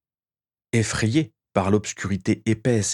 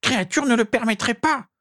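very high, squeaky indignation; high-pitched scandalised house-elf; indignant elf. A narrator's reading. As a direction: protest in a tiny, high creature voice. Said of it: "s'indigna l'elfe".

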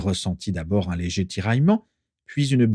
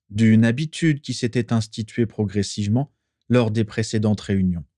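ordinary delivery

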